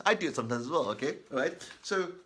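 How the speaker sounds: ordinary delivery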